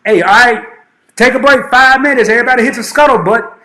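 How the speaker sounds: loud, high drill instructor call; shouted order. Retold or imitated. Recruit instructor calling a break to the recruits, loud and high. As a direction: bark it like a boot camp instructor. Quoting a boot camp order.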